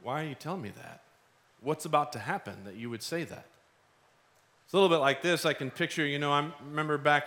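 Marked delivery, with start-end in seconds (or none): 0.0-3.5 soft, low, wondering questions; hushed expectation. Acted. Widow looking at Jesus, quiet expectation. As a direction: ask quietly, waiting for what comes next. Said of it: "with expectation, like".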